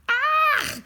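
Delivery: high, loud startled shriek. A narrator's reading. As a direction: farmer shrieks in fright. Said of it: "shrieked the farmer".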